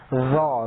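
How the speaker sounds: "زا" as a heavy, rounded zaw; thickened z. Shown as a demonstration. Teacher showing the letter zoy, thick rounded "زا". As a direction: buzz into a thick, dark "زا". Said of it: "زا کو موٹا کر دیتے ہیں".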